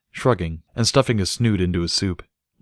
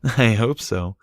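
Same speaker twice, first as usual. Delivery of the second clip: breathless; 4 semitones higher, slower than usual. A narrator's reading. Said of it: "Barry said breathlessly".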